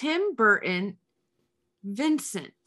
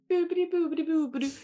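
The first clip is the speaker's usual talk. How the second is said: high, airy little chant; sing-song it like a dreamy girl; playful high mimic of the actress; mock Winona voice; 5 semitones higher, 4 dB quieter, faster than usual. Mimicking Winona shaving ice.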